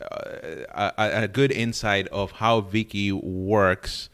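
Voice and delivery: ordinary delivery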